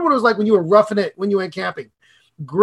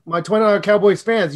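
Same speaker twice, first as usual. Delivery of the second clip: normal talking voice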